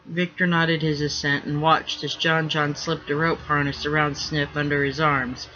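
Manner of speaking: usual speaking voice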